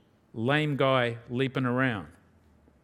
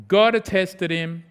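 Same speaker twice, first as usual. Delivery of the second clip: ordinary talk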